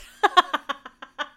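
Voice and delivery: helpless giggle at an accidental pun; real laugh, caught off guard; amused laugh. Real, not acted.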